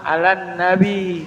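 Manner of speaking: soft, slow "alan nabi" with held nn; correct tasydid. Shown as a demonstration. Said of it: "Ada tasdid". ("alan nabi" dengan nun bertasydid)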